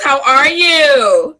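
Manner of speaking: loud, high, drawn-out hello; slow, booming talk-show welcome; excited greeting. Real, not acted. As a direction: greet her like a long-lost friend. Host greeting guest on show.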